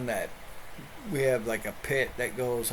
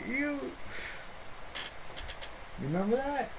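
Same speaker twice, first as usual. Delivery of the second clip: dog's voice; 10 semitones higher, 10 dB quieter than usual. Pretend. Voicing dog arguing with mom.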